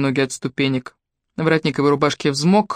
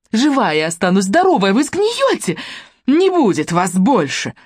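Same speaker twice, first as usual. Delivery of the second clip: frenzied rant; 5 semitones higher than usual. A narrator's reading. Tomsha rages at Polina, hurling frenzied high shouts. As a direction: hurl the words at her face in a frenzy. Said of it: "В неистовстве она швыряла слова в лицо девушки".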